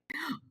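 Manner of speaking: sharp delighted gasp; excited gasp. Real, not acted. Guest hearing her favorite topic, gasping with delight. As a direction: gasp with real delight at the question.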